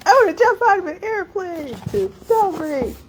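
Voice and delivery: put-on very high, girlish excited voice; eager student. Graduate student pleading to celebrate with a jump. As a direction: squeal it like a giddy student. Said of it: "she was like".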